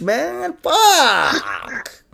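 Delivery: usual speaking voice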